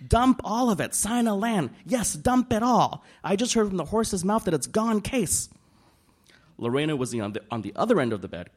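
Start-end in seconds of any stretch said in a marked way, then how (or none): 0.1-5.5 much higher and louder, yelling orders; screaming into phone. A narrator's reading. Nadine screams orders at her stockbroker, loud and frantic. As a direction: bark sell orders down the phone. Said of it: "screaming into her phone".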